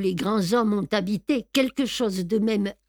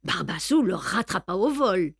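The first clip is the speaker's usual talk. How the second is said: ordinary delivery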